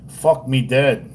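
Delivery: slow, lightly put-on Australian accent; attempted Australian twang; mock Australian. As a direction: drag it out in imitation Australian. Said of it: "they say".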